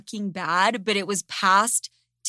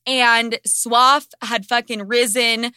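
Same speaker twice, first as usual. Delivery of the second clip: usual speaking voice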